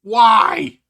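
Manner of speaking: shouting why in disbelief; exasperated shout. Real, not acted. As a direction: yell it out, fed up and baffled. Exasperated outburst at Phil.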